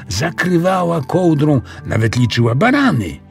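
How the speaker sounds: plain reading voice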